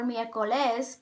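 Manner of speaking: "miércoles" wrongly stressed on LES, the last syllable; wrong final stress. Shown as a demonstration. Teacher showing another mistake, "miércoles" stressed on last syllable. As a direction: say "miércoles" with the weight on the end. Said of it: "It isn't pronounced miércoles or".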